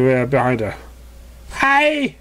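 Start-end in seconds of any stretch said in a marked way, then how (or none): 1.5-2.2 high, bright "Hi!"; eager greeting. Acted. New Pegasus greeting Twilight, high and eager. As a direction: burst in with a cheery hello. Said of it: "said the Pegasus".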